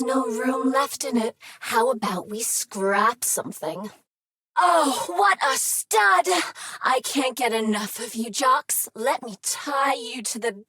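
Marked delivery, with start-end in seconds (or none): 4.6-6.3 swooning exclamation. Acted.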